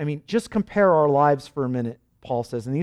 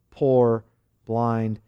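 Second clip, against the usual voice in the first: ordinary talk, nothing marked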